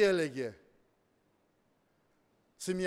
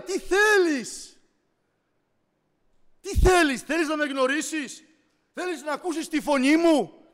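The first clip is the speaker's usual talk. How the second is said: calls out each name, building higher and louder; rising preacher's fervour, slowing on the last questions; preacher building to a cry; fiery preaching; 9 semitones higher, 11 dB louder than usual. Acted. Preaching at full force.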